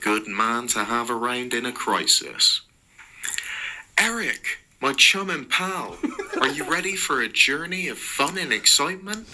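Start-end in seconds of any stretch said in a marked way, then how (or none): 4.0-9.3 high, fast, laughing excitement; exclaiming. Acted. Mark greeting his bodyguard Eric, high and excited. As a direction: gush it, barely holding a laugh. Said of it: "exclaimed Mark".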